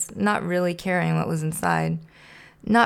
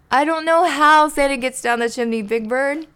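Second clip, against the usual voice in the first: high, loud admission of defeat; loud, high-pitched exasperation; exasperated outburst; 8 semitones higher, 6 dB louder than usual. Acted. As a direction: blurt it out in frustration. Kermit gives up trying.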